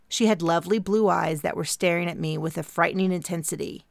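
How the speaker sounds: usual speaking voice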